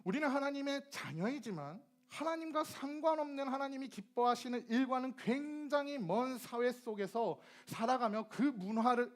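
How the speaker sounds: ordinary talk, nothing marked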